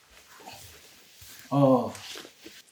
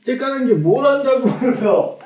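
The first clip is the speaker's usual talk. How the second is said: high, loud exaggerated protest; indignant outburst; 11 semitones higher, 9 dB louder than usual. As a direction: burst out in a comic voice.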